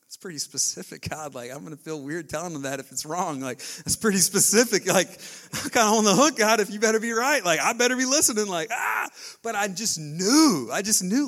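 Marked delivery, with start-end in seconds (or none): 3.4-11.3 rising excitement. Real, not acted. Preacher building to excitement.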